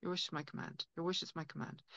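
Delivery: a soft, hushed genie granting wishes. Acted. A little genie inside you, softly granting wishes. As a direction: murmur it like a genie repeating a promise. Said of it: "a little genie inside you saying".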